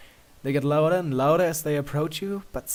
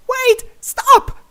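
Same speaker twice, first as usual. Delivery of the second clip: much higher, louder desperate shout; cry out; 17 dB louder than usual. A narrator's reading. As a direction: shout to stop him leaving. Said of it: "she cries".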